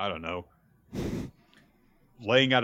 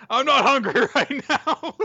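much higher, laughing through the line; 4 dB louder than usual. Retold or imitated. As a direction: giggle your way through the quote. Werewolf quote, laughing.

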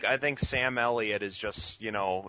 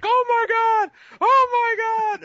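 much higher, much louder shriek; mock audience screaming; 12 dB louder than usual. Mimicking the berserk theater audience in a wild shriek. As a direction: squeal like a thrilled crowd. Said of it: "It was like".

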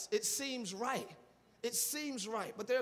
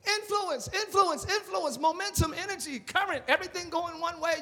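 building shout; 6 semitones higher, 4 dB louder than usual. Real, not acted. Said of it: "preacher building to a shout".

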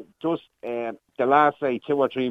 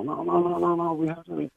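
retold protest; 9 semitones higher, 3 dB quieter than usual.

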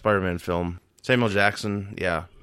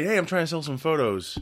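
higher-pitched hopeful pitch; raised, casual quoted voice; Peter Parker; 7 semitones higher than usual. Retold or imitated. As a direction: lightly act out a kid offering photos. Voicing Parker walking in.

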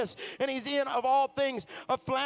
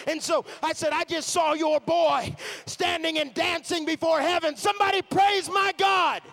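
shouted, rising sermon climax, slowing on the big line; preaching at full force; 6 semitones higher, 5 dB louder than usual. Acted. The preacher shouts the heaven vision to the crowd. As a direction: build it into a shouting altar call.

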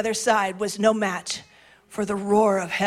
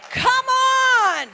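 fired-up cheer; 13 semitones higher, 4 dB louder than usual. Real, not acted. Preacher urging the crowd on.

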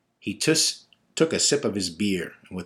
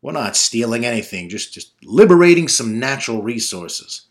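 retort; 3 semitones higher, 10 dB louder than usual. Acted. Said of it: "he retorted".

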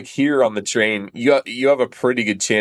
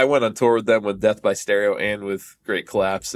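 ordinary delivery

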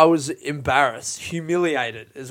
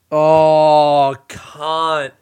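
loud sudden oh; 9 dB louder than usual. Real, not acted. Sudden exclamation.

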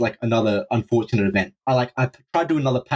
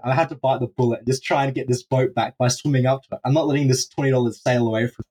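plain reading voice

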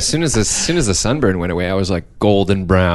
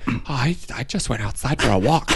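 sheepish reply; 5 semitones higher, 5 dB quieter than usual. Retold or imitated. Ginger replying in a put-on voice.

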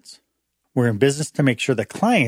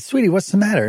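concerned husband; 7 semitones higher, faster than usual. Retold or imitated. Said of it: "and I said".

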